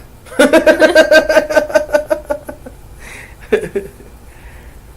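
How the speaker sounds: high, loud rapid-fire laugh; big high laugh, tapering off; loud cackle. Real, not acted. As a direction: burst into a long staccato cackle. Laughs loudly at own story.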